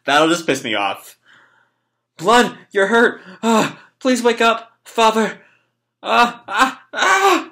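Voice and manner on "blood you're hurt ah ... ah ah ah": high distressed pleading into rising cries; plead, then break into high cries; acted panic. Boy begs dead father to wake up, acted panic.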